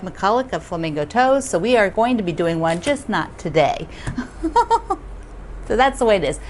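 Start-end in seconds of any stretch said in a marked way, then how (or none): 3.9-5.6 high giggle. Real, not acted. Laughing at own excuse.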